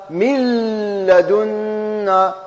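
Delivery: من لدنا with the nun merged into the lam, very slow and drawn out; slow tajweed demo. Shown as a demonstration. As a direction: stretch لدنا slowly like a Quran teacher. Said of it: "أقرأها؟". (قراءة مجودة بطيئة لمن لدنا)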